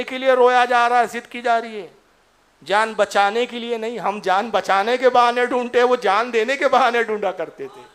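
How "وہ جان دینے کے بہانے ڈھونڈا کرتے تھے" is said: ordinary talk, nothing marked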